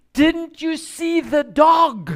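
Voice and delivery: high, loud, slow alarmed shout; alarmed lady. Retold or imitated. Homeowner warning kids about her dog, loud shout.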